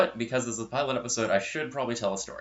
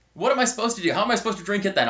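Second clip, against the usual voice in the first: much higher, louder imitated complaint; exasperated protest; 9 semitones higher, 4 dB louder, faster than usual. Burned friend asking how to drink tea, imitated exasperated protest. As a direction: protest in mock helpless frustration.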